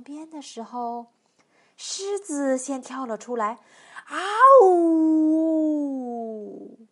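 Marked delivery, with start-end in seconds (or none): 4.1-6.8 loud, long "aooo" roar; drawn-out beast howl, falling away; lion's scare roar. Acted. Lion leaps out to scare her.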